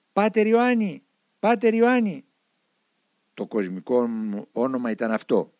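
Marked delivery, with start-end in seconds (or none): 0.1-2.2 higher, louder call across the harbour. Retold or imitated. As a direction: call his name out from afar. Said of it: "άρχισε να φωνάζει". (δυνατό φώναγμα από μακριά)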